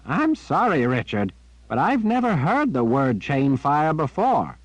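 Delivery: put-on high, puzzled, apologetic old man; sorry and bewildered. A narrator's reading.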